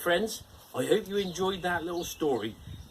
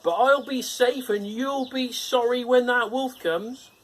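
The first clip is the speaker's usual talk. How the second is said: calling pig; 10 semitones higher, 6 dB louder than usual. Acted. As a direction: warn your brothers as they leave. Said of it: "their brother called after them".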